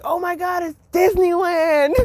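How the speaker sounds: high, laughing, teary cry of delight; giddy shriek, laughing and near tears; overjoyed. Retold or imitated. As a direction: burst out like a kid seeing Disneyland. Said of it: "it's like".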